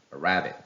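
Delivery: "a rabbit" with stress on the second syllable, RAB; wrong stress. Shown as a demonstration. Teacher shows wrong pronunciation of Arabic, stressing "rabbit" after a weak "a". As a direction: hit RAB, leaving the opening "a" weak. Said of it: "the wrong pronunciation with the stress on the second syllable".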